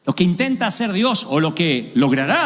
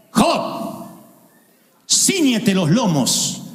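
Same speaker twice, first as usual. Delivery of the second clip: slow, commanding divine voice; voice of God; much slower than usual. Acted. God answers Job in his crisis, slow and commanding. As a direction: speak down as the Almighty. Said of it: "y le dice".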